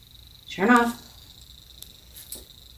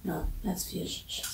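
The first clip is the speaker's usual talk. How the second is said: usual speaking voice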